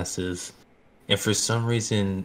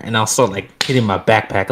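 usual speaking voice

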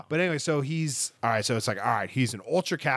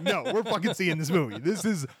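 laughing defiance; 6 semitones higher than usual. Retold or imitated. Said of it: "We're like".

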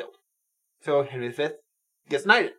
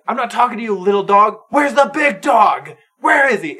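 loud, raised mock battlefield taunt; taunting challenge; 6 semitones higher, 7 dB louder than usual. Retold prince calling out his real rival, loud mocking challenge. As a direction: call out the big challenger, drawn-out and loud.

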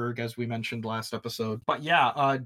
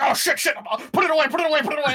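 high, louder, flustered scramble; hammed-up panic, hiding the evidence; mock panic; 13 semitones higher, 6 dB louder, much faster than usual. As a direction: pretend cops caught off guard, frantic. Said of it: "they're like".

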